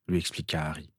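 ordinary delivery, nothing marked

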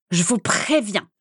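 understated irate warning; grumbling anger. A narrator's reading. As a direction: warn them crossly, stressed. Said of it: "pestait Valburga". (pestant, avertissement agacé)

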